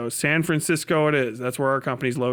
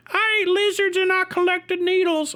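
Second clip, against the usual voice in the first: much higher dim desert local, proud of it. Retold or imitated. Voicing a desert Californian.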